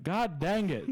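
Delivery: mock-upset southern exclamation; upset southern accent. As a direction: let a southern twang burst out when upset. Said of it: "when I get upset or I get excited".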